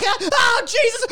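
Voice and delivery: high hammed-up cries of pain; mock pained cries. Gina being beaten by brooms, mock pain. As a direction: cry out as if hit.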